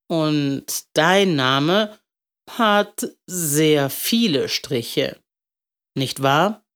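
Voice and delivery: very slow, understanding; gentle old man. Acted. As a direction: coax it out of the child slowly.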